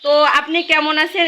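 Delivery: ordinary talk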